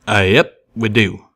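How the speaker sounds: a train pony's homespun drawl; folksy train pony. Acted.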